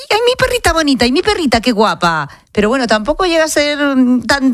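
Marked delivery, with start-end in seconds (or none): 0.1-2.3 put-on high cooing baby voice for a pet; baby talk to a dog. Shown as a demonstration. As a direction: coo at your puppy, much higher. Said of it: "le hablas con voz de bebé".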